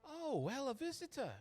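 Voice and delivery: high, airy woman's greeting; older shopkeeper woman. Acted. Madam greeting a newcomer, older woman's high voice. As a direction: greet a visitor in a lilting high voice. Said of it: "an older woman in her forties".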